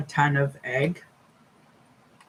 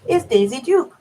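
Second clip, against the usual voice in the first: lightly raised coaxing voice; pet talk; 7 semitones higher than usual. Acted. Introducing her cat Daisy to viewers, light coaxing pet voice. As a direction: coax the cat over, a little higher.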